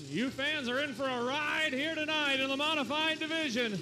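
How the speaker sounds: loud, high hype call to the fans; hype announcer. Real, not acted. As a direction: pump up the crowd. Announcer hyping crowd.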